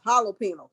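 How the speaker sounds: jalapeno as "hah-la-PEE-no"; silent J. Shown as a demonstration. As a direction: leave the J silent in jalapeno, use an H. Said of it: "'cause the J is, you know, s-, silent".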